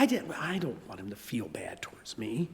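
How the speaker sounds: hushed confessional whisper; whispered aside about parents' real motive. Real, not acted. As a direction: admit it under your breath. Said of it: "I know why as parents".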